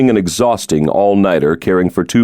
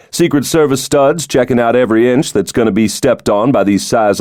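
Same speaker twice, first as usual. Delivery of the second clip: higher-pitched, jovial, confident politician; genial president; 4 semitones higher than usual. Acted. The president jokes to his old roommate, jovial and higher. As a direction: play the charming president joking about his security.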